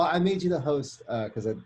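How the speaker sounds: ordinary talk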